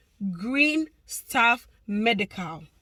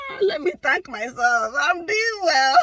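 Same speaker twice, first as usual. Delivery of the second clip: loud, higher, laughing brag; 5 semitones higher, 5 dB louder than usual. Real, not acted. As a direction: laugh through the self-praise. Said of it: "forgive me for laughing".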